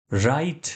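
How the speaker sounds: "right" starting straight on R, no W; silent W. Shown as a demonstration. Teacher showing learners the word "right", silent-W pronunciation. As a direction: say "right" leaving the written W silent. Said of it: "ડબલ્યુ નો ઉચ્ચાર નથી કરવાનો".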